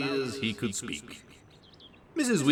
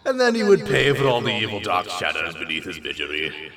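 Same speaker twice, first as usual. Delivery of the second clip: ordinary delivery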